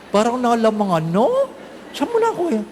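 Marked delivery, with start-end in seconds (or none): 1.9-2.7 envious neighbour. Retold or imitated.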